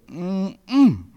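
high "mm" of mock relish; pretend savoring. Preacher pretends to eat a baby's tear, high savoring hums. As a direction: hum as if tasting something delicious. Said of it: "I play like I'm eating it".

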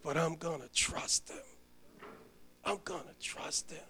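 hushed resolve, barely above a breath; preacher quoting his dying friend's quiet trust; quiet faith. Retold or imitated.

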